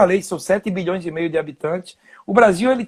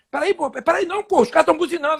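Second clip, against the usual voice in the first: nervous quote; 7 semitones higher than usual. Retold or imitated. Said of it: "você fica nervoso".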